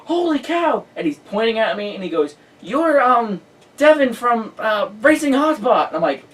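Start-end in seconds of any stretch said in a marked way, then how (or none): 0.1-0.8 high, excited holy cow; shocked exclamation. Retold or imitated. Sean spotting the interviewer, shocked and thrilled. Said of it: "face went from talking like a normal conversation to". 2.6-5.9 higher, louder starstruck gush; excited recognition. Retold or imitated. Sean recognizing the reporter, excited and higher.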